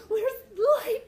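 high, loud mock panic with laughter; teacher acting out her panic in the dark hallway, laughing. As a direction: cry out for the light, half laughing. Panicked retold question.

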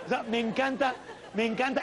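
ordinary talk

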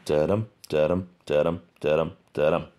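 "Da-dum" five times, weak beat then strong. Shown as a demonstration. Teacher counting out the five feet of pentameter. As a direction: beat out a full line of five iambs. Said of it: "Because it's pentameter, pent five".

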